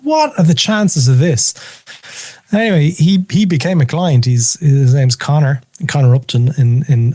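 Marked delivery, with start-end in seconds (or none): 0.0-1.5 high, slow incredulous question; high-pitched, amazed quote; retold disbelief. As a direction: re-enact marvelling at the odds.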